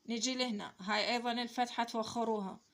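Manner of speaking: ordinary talk, nothing marked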